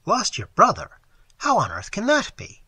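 astonished. Acted. Phillips reacts to the lost brother, surprised and loud. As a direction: blurt it out, caught off guard.

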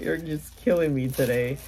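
usual speaking voice

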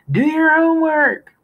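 very high, loud bellow. A narrator's reading. Father bellowing back as he leaves the house. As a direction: hurl it back over your shoulder. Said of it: "bellowing back".

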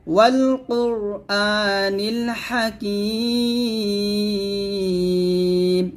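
slow chant, wall drawn out long; tajweed recitation. Shown as a demonstration. Teacher showing students the right recitation. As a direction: stretch every vowel in a slow chant. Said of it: "এভাবে".

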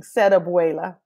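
ordinary delivery

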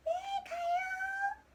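high drawn-out call. Real, not acted. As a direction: call back loudly across the house. Calling back from another room.

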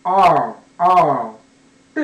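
あー long and loud, tongue touching nothing; tongue-free R. Shown as a demonstration. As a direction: draw out あー as an English R, falling away. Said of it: "につけずに、そのまま".

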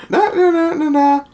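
high sing-song nah-nah noise; imitated toy noise. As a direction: mimic a gadget in high little nahs. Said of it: "it's like".

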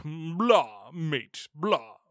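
badly faked English accent, drifting Australian; mock English accent. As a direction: attempt a hammy English accent. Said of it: "say that more English-like".

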